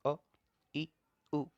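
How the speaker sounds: "अ, इ, उ" each cut to one short beat; short vowels. Shown as a demonstration. Teacher showing students one-beat vowels, short vowels. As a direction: say "अ", "इ", "उ" briefly, separated by pauses. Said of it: "जिनके उच्चारण में कम समय लगता है".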